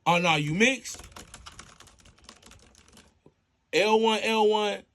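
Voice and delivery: high and loud the whole way, gamer hype; hyped up. Acted. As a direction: yell like you're in the game with him. Dispatcher hyped coaching shootout game.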